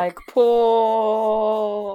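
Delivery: loud, drawn-out name; mock-epic booming call; mock vision. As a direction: stretch the name out loudly. Mock prophetic vision cry.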